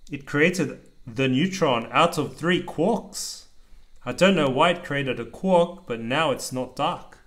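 steady rhythmic picture-book reading; reading aloud to child. A narrator's reading. Dad reading a rhyming book to his child, lilting read-aloud. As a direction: read the rhyme out to your kid.

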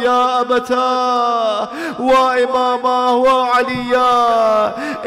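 long, loud drawn-out wail of grief; loud, slow wailing cry of a grieving son; crying out aloud. Acted. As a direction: stretch each cry out as a keening call to a father. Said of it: "starts to cry out aloud".

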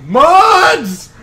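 loud high summoning yell; calling out. Pretend. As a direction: shout for the moderators. Calling for moderators.

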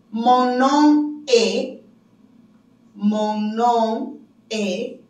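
very slow, word-by-word French; slow repeated model. Shown as a demonstration. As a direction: stretch out each word for learners. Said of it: "volver a repetir".

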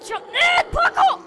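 high, loud burst; excited action shout. Real, not acted. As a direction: shout it as you leap. Jumping in game.

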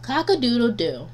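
ordinary delivery

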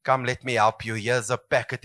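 a gentle, soft offer of help; tender. Retold or imitated. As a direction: soothe them like a baby. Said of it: "and then you say".

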